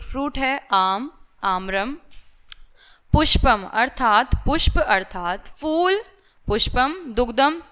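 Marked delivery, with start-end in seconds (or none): none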